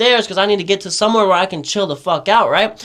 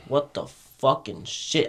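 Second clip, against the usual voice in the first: stunned inner mutter; 7 semitones lower, 9 dB quieter, slower than usual. Retold or imitated. Seeing clay people, tripping.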